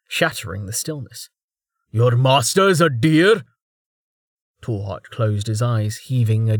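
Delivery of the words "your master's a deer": slow, incredulous scratchy yell; scratchy shout. A narrator's reading. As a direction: bark it out in disbelief. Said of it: "A scratchy voice suddenly shouted".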